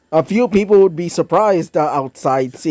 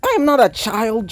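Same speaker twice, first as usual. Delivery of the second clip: higher-pitched, mock-indignant retort; indignant protest; 7 semitones higher than usual. A narrator's reading. Mirai answers his child tease, indignant.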